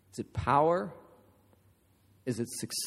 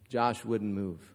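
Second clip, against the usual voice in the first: ordinary talk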